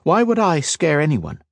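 high, indignant question; demanding. A narrator's reading. Kat challenging the healer's warning, demanding. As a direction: push back for an answer. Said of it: "she demanded".

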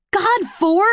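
high-pitched frustrated burst; exasperated snap. A narrator's reading. Tris is frustrated past speaking, then snaps at Four. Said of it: "I snap".

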